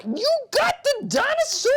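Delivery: squeaky high playful question. Acted. Therapist teasing child with a toy, squeaky high. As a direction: pitch way up to tease the child.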